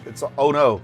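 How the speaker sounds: ordinary delivery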